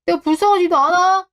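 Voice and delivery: a complaining son, pitched high; whiny plea. Acted. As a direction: beg for pity like a spoiled son. Son pleading in a quarrel.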